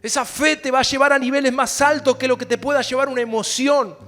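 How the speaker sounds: prayer that grows into loud preaching; building prayer. Acted. Pastor praying aloud for the congregation, building to loud. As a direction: pray it up from steady to loud.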